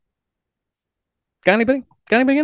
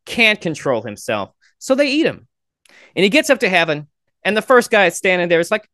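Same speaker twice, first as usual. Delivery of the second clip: ordinary talk, nothing marked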